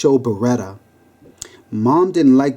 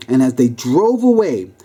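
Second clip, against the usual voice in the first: usual speaking voice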